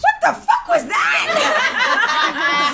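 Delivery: very high, slow retold squeal; hammed-up appalled shriek; shocked disbelief. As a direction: stretch it out in mock horror. Acted reaction after closing the door.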